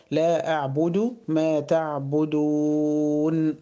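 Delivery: long held لا, then a long final vowel in تعبدون; slow recitation stretching the end of تعبدون; madd at the pause. Shown as a demonstration. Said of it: "برضه هنا مد عارض للسكون". (مدّ لا وآخر تعبدون عند الوقف)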